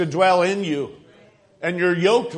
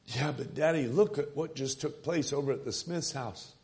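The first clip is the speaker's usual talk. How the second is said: put-on much softer and lower, an uneasy child; hushed worried boy; 5 semitones lower, 12 dB quieter than usual. Son questioning his father about the neighbours, hushed worried objection. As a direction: murmur the doubt close to your father.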